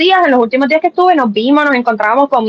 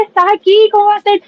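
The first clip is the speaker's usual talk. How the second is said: high, disbelieving exclamation; mock shocked squeal; retold surprise; 9 semitones higher than usual. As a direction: react like the news just hit you. Retold surprise at friend's message.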